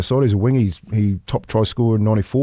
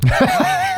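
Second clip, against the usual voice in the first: burst of laughter. Real, not acted. Guest cracking up at the mascot's complaint, high laugh.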